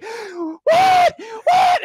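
very high, loud, confused yell; startled shout. Retold or imitated. Waking to screams, shouting in high panic. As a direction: jolt awake and shout in panic. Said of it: "then I'm like".

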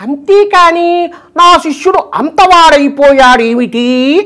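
high, slow pravachanam voice; high measured spiritual discourse delivery; Garikapati impression. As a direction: preach it slowly like the Telugu discourse master. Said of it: "మన గరికపాటి గారు".

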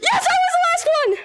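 high excited laughing shout; 1 laughing shrieks. Real, not acted. Laughing about being last survivor.

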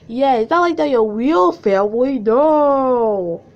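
loud, high mock indignation; exasperated sarcasm building to a yell; sarcastic rant. Real, not acted. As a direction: protest loudly, ending on a long high no. Sarcastic rant about the word adopted.

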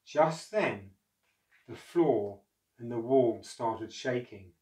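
ordinary delivery, nothing marked

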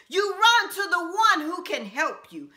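ordinary talk

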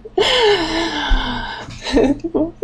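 long, high giggle of nerves; nervous laugh. Real, not acted. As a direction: break into a drawn-out, high-pitched laugh. Nervous laughing fit on camera.

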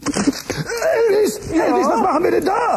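aufgeregter Ruf. Acted. Figur ruft aufgeregt nach Elvis.

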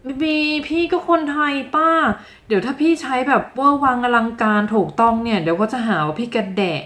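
high-pitched mock objection, easing down toward the end; mock protest. Learner objecting to teacher about sounding pretentious, mock protest. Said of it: "บางคนบอกว่า".

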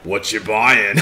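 low merchant voice asking what you buy; the shady game merchant at the counter; Resident Evil merchant. Retold or imitated. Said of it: "That's the Resident Evil four merchant".

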